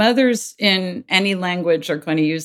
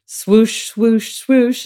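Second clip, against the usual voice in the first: heartbeat swoosh; 6 semitones higher, 5 dB louder, much slower than usual. Shown as a demonstration. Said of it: "nothing but heartbeat".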